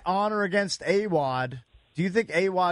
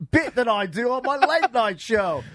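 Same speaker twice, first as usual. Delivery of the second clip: higher, hammed-up British accent; James Corden, Wayne Rooney; 6 semitones higher than usual. Radio host imagines a talk-show star interviewing a footballer, mock British accent. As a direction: put on a cobbled-together British accent, raised in pitch. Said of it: "what would it sound like if James Corden interviewed Wayne Rooney".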